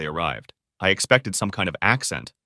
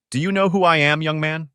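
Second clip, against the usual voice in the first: lightly voiced older man, higher pitch; a higher, pointed question from an old man; challenging old man; 7 semitones higher than usual. Old man confronts a stranger at his porch, challenging.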